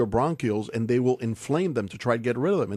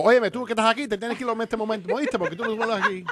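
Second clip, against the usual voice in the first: higher, laughing Spanish scolding; mock Cuban official; 9 semitones higher, much faster than usual. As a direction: play a Cuban border guard ordering you out, half laughing. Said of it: "No, they will go".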